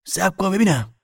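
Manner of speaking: high, loud order to wait; heated, urgent demand; angry command. A narrator's reading. Man stops her in quarrel.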